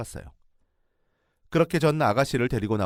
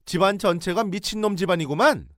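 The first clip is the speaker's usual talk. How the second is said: angry outburst; 10 semitones higher, 5 dB louder than usual. Retold or imitated. In-law insults family.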